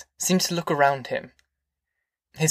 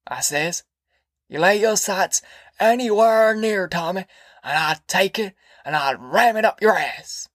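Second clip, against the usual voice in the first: put-on raised, rough old man talking fast about jail; old Grandpa voice; 4 semitones higher than usual. A narrator's reading. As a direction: play a boastful old grandpa ranting. Grandpa's voice in reading.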